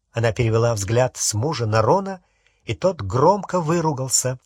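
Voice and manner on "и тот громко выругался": usual speaking voice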